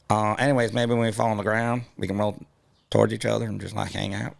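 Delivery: lightly Southern Georgia peach voice. Acted. Georgia peach chatting up the peaches on the next branch. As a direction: play a friendly Georgia peach, easy and chatty. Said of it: "Georgia accent".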